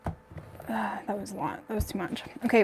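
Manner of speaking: normal talking voice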